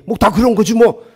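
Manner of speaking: loud, high blunt brush-off; a hint of a gruff pastor; curt mock grumble. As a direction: toss it off bluntly. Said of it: "퉁명스럽게 막 그래도요".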